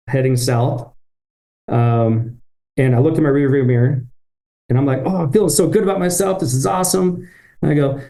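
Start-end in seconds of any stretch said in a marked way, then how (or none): 5.0-7.3 mock proud glee. Proud inner thought, retold.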